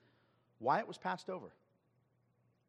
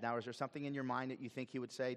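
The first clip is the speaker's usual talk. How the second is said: normal talking voice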